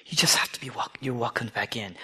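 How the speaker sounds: whispered invitation home; soft whisper. Acted. Tax collector imagines welcome home, whispered.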